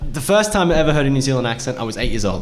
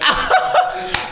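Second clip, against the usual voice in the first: sudden high-pitched laughing fit; high burst of laughter. Retold or imitated. Kid laughing hard at the TV news, high laughter.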